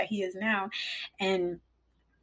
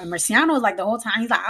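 usual speaking voice

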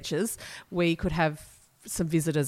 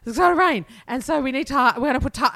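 higher, louder mock of a worried mum; a hint of her anxious mother; imitating fretting mum; 7 semitones higher, 5 dB louder, faster than usual. Daughter mocking her mum's worry about rain, higher and louder.

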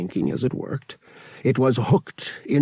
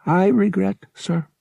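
high, mechanical droid voice; formal droid; 11 semitones higher, 4 dB louder than usual. A narrator's reading. Droid addresses the stowaway pilot, formal and mechanical. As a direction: regret it politely, like a machine.

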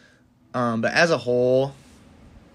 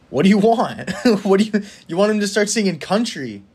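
louder, higher exasperated push-back with a laugh; 7 semitones higher, 4 dB louder than usual. Real, not acted. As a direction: come on, what more do you want from him. Exasperated rant about critics.

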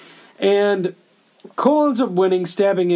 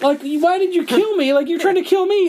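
high whiny why did you kill me; confused dying victim, pitched way up; dying kid voice; 9 semitones higher than usual. Retold or imitated. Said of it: "he gives a face out and a shrug".

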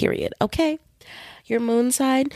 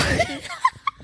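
high giggle. Real, not acted. Laughing at own confession.

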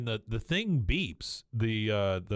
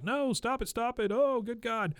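much higher, pleading mock squeal; shrill plea done as a falsetto send-up; high mock panic; 12 semitones higher than usual. Peggy begging the guards to stop, mock falsetto panic. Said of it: "And Peggy's like".

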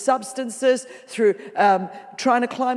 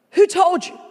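higher and louder, pressing on "Who told you?"; loud, pressing question; 7 semitones higher, 7 dB louder than usual. Acted. The Lord presses Adam again, loud insistent question. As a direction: press the question home with force. The Lord says these words.

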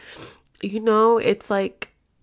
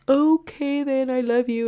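imitating boyfriend; 6 semitones higher than usual. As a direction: stretch it out high, as his phone goodbye. Mimicking boyfriend's reluctant phone goodbye.

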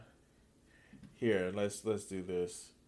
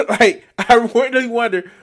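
laughing; 10 semitones higher, 8 dB louder than usual. Real, not acted. Sarcastic laughing reaction.

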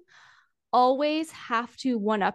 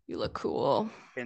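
indifferent cool guy; 11 semitones lower, 7 dB quieter than usual. Retold or imitated. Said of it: "it's like".

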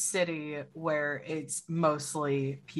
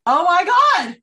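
loud, high shocked cry; shocked shout; 13 dB louder, much slower than usual. Real, not acted. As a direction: blurt it out in disbelief. Shocked outburst.

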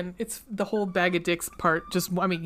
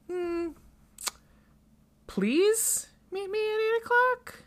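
high, rising disbelief, much higher; mock-incredulous repeat; incredulous question; 13 semitones higher than usual. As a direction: echo his words back as a doubtful question. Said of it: "She goes".

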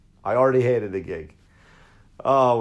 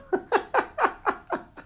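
burst of laughter. Real, not acted. Laughing at his own story.

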